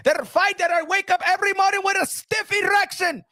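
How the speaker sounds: put-on high-pitched, heavy-accented boss ranting; the Turk impression. The Turk scolding Canelo over the Crawford fight, high and ranting. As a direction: lecture Canelo like the man who pays him, rising to shouts. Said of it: "You understand the Turk?".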